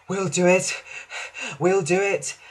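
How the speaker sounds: audible puffs between determined phrases; pant, then push the words out; out of breath but determined. A narrator's reading. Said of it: "panted Thomas".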